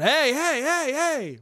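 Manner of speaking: high, drawn-out retold calling out; real loud calling. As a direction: call out to cut in on someone. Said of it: "real loud".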